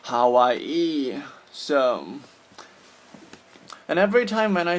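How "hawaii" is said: "Hawaii" as ha-wa-EE, ending in long ee; weird pronunciation. Shown as a demonstration. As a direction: sound out "Hawaii" with a separate final ee. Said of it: "correct your weird".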